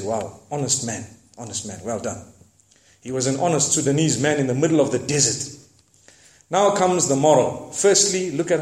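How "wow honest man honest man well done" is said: hushed admiring praise. Retold or imitated. Quoting YouTube praise softly.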